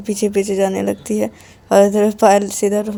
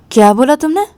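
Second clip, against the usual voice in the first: higher, louder outraged challenge; 7 semitones higher, 6 dB louder than usual. Acted. Payal sits up and challenges Ananya's taunt, outraged. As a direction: dare her to repeat it.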